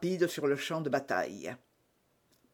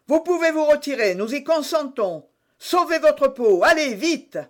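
high, raised-voice urging; commanding shout; 8 semitones higher, 5 dB louder than usual. A narrator's reading. Jussac urges the young man to flee, calling out. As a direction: loudly wave the youngster away. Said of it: "cria Jussac".

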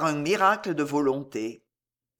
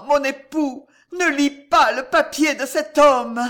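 high, loud desperate begging; terror-stricken woman's cry, insistent and raised; horror and dread; 7 semitones higher, 6 dB louder than usual. A narrator's reading. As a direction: plead frantically with your lover. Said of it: "toute l'épouvante étaient dans la voix d'Alice".

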